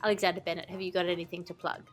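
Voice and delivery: ordinary talk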